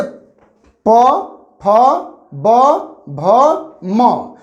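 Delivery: प, फ, ब, भ, म drawn out very slowly, one at a time with pauses; slow sounding out. Shown as a demonstration. Teacher sounding out letters for exam students, very slowly. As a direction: let each lip sound ring separately. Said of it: "जैसे".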